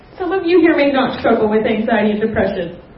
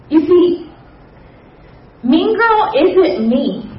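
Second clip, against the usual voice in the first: ordinary talk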